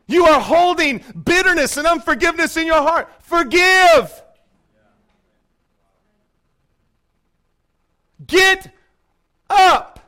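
loud, slow, high call; fervent exhortation. Real, not acted. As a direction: urge them to rise, hammering each word. Preacher calls congregation to act.

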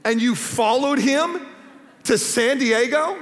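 mock incredulity. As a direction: stretch out each phrase as if baffled. Acting out his younger incredulous self.

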